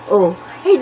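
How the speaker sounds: ordinary talk, nothing marked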